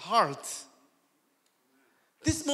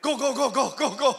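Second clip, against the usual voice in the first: hurrying along; 2 semitones higher, 4 dB louder, faster than usual. Retold or imitated. Acting out wife hurrying him.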